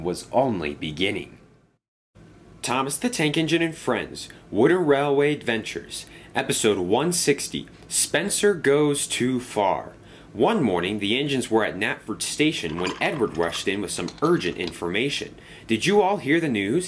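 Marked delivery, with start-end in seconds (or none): 2.6-9.9 bright announcer read of the episode title; title announcement. A narrator's reading. Reads title card announcement. 15.7-16.9 quick asking. Acted. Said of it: "he asked quickly".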